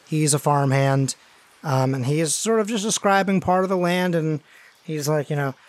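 ordinary talk, nothing marked

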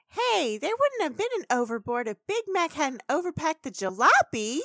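high, indignant retort; bickering pony. Retold or imitated. Pony bickering with family over the overboard, indignant and high. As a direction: pin the blame on someone, pitched high.

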